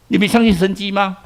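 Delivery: normal talking voice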